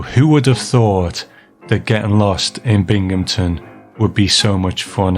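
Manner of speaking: ordinary talk, nothing marked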